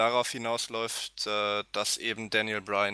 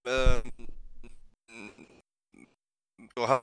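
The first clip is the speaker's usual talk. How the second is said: choppy breaking-up call audio; glitchy lagging audio; 5 dB louder than usual. Real, not acted. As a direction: voice stuttering through a bad connection. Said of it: "Warte mal ganz kurz, [name]".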